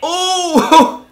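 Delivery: high, loud burst of surprise; shocked yell. Real, not acted. Watching a fail video, loud shocked yelp. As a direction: yelp at the hit.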